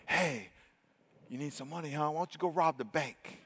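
very soft, confidential nudge; hushed, sly suggestion; mock divine whisper. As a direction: drop right down like a secret voice in the head. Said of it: "God's gonna whisper to you".

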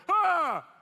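loud, high shocked gasp; big retold gasp of amazement; startled gasp. Startled reaction as stomach moves.

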